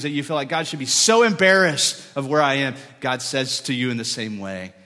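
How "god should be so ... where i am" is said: anguished outburst. Retold or imitated. Voicing a despairing sinner.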